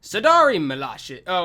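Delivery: ordinary talk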